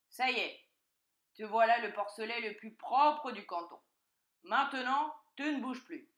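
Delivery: loud, emphatic announcement; triumphant fox. A narrator's reading. Fox to freshly washed piglet, loud and commanding. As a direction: announce it firmly, then command.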